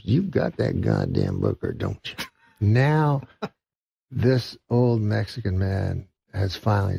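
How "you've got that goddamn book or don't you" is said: deep menacing voice. Retold or imitated. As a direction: drop way down and threaten. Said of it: "He goes".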